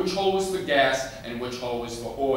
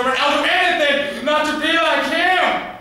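desperate scream; 14 dB louder than usual. Acted. Boy begs Jesus not to become like Daddy, desperate screaming. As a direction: scream the plea at full force.

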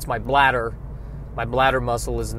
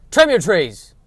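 loud, higher, grudging give-in; exasperated shout; 5 semitones higher, 8 dB louder, slower than usual. Real, not acted. Host conceding to the tree trimmers, exasperated loud shout. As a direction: throw up your hands and yell it.

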